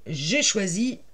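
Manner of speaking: ordinary talk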